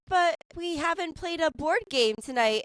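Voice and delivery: a hint of a pleading child; mock whiny daughter. Daughter begging for a board game, mildly whiny. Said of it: "she'll be like".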